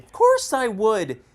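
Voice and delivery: emphatic. Acted. Emphatic retort from the pot.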